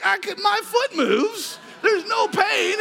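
very high ecstatic shout, imitated; going crazy. Woman discovers her fused foot moves, ecstatic shouting. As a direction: shriek her joy at full excitement. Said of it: "going crazy".

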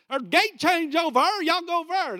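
high, loud country Appalachian holler; Big Stone Gap accent. Acted. Preacher mimics a gate announcer for the congregation, loud Big Stone Gap accent. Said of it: "Big Stone Gap".

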